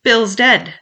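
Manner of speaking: high, loud cry of alarm; shouted news. A narrator's reading. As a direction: shout it out from the house. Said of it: "a voice shouting from the house".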